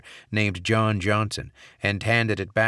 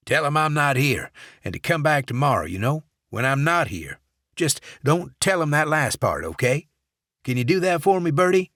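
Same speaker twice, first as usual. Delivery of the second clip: higher, louder grumpy boss; a grumpy manager dodging visitors; gruff voice; 7 semitones higher, 4 dB louder than usual. Acted. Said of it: "gruff voice".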